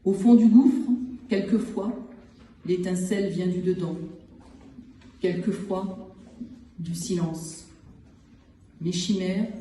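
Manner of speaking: measured poem recital with long pauses between phrases; poetry reading. Acted. Poet reads short poems from the collection to listeners, measured recital. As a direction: set each short phrase apart, letting silence follow.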